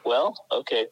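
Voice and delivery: ordinary delivery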